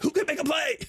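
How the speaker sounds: high mock hype call; T.O. greeting. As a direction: greet a fan, full of hype. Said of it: "he'll be like".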